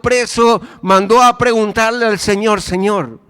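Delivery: high, loud preaching at full force; impassioned preaching. Acted. Preacher telling the congregation the Father works through him, impassioned. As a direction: preach it out loud and high, driving each phrase.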